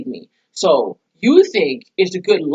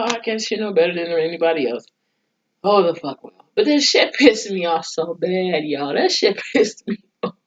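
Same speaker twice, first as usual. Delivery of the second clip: ordinary delivery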